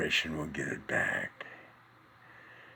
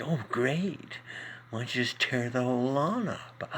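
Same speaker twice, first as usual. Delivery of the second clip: sarcastic wife; 5 semitones higher than usual. Retold or imitated. Second guy quoting his wife's sarcastic nagging. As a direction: sneer it like a fed-up wife. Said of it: "my wife saw me do that".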